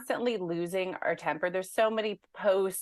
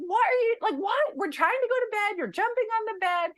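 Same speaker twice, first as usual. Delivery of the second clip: exasperated yell. Retold or imitated. Mom imitating her past self scolding her jumping daughter, mock yell. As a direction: play the old snapping mom at bedtime. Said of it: "maybe I'd be like".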